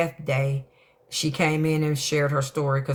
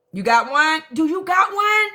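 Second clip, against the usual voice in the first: excited plea; 13 semitones higher, 5 dB louder than usual. Real, not acted. Begging viewers for the clip.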